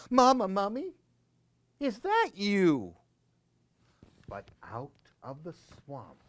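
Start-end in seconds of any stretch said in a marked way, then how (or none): 0.1-3.0 put-on high, small child's call; high little voice calling for mama; little baby mummy. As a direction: call out like a tiny lost child. Baby mummy calls into swamp.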